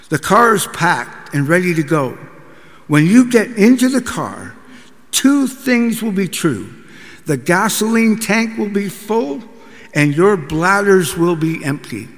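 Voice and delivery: loud barked commands; drill sergeant. Retold or imitated. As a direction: order the family around like a sergeant. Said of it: "bark out orders like a drill sergeant".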